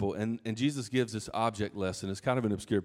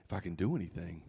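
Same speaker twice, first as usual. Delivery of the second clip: softer, echoing the father's words back; Jesus repeating the doubt; 6 semitones lower, 8 dB quieter, faster than usual. Retold or imitated. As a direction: hand the doubt back gently. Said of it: "It's literally in there".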